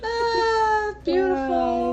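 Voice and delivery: high voice through laughter; laughing delight. Real, not acted. Game master enjoys the bees joke, real laughing delight. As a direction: sigh it out high at the tail of a laugh.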